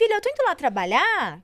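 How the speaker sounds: high, loud sing-song parent voice; mother to daughter. Retold or imitated. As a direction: imitate a mother announcing she is leaving for work. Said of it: "que eu falo pra minha filha assim".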